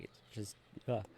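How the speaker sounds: trailing off into a sneeze; breathy ugh from allergies; sneezing. Real, not acted. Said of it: "sneeze like crazy".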